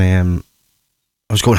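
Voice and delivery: usual speaking voice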